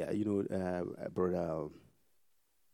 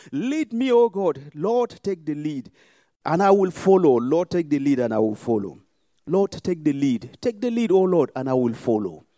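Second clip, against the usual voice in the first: loud, high, impassioned crying out to God; shouted prayer; 5 semitones higher, 11 dB louder than usual. Real, not acted. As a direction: pour it all out, full voice.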